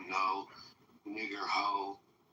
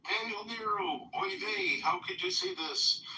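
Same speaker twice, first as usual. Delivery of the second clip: high, put-on Yiddish accent; exaggerated Yiddish accent, pitched up.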